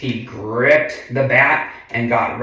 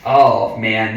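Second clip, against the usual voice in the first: ordinary delivery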